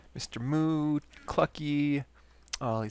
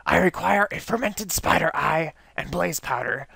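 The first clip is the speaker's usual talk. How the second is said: raspy and a bit louder; raspy scientist voice; 3 semitones higher, 5 dB louder than usual. Acted. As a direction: rasp it out like a scheming mad scientist. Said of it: "my raspy voice is killing my throat".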